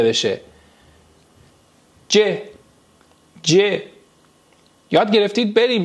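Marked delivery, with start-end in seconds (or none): 2.1-3.8 letter C said "ج", as je; Turkish letter name. Shown as a demonstration. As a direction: say "ج" with a j sound, je. («ج» به صورت جِ ترکی)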